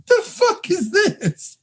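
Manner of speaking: high, laughing "what is this"; laughing disbelief. Real, not acted. Baffled by awful movie dialogue.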